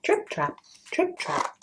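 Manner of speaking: high, soft little trip trap; tiny hoof steps. Shown as a demonstration. Narrator sounding little goat hooves, high and soft. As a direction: tap it out light like tiny hooves. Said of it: "Little Billy's shiny, tiny hoofs".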